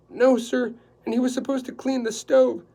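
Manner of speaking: a high, loud, flustered housekeeper; anxious housekeeper. Acted. Housekeeper explaining the dinner to Quillerin, flustered and high. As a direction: rattle it off in a nervous flurry.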